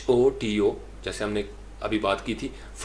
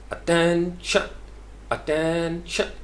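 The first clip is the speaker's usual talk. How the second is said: Attention as a-TEEEN-shn, high, clipped last syllable; sustained high TEN in attention; drawn-out TEN; 6 semitones higher, much slower than usual. Shown as a demonstration. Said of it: "टेन जो है उसको लंबा करना है".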